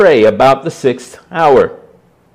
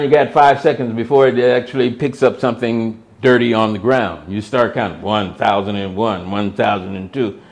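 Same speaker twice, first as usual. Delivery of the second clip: quiet aside; 6 semitones lower, 7 dB quieter, faster than usual. Real, not acted. Mutters the counting aside.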